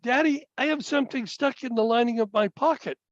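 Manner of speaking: a hint of a young child's voice; lightly voiced little boy. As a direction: pitch it up like a boy. Voicing young son in a story.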